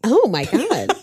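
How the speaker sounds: ordinary talk, nothing marked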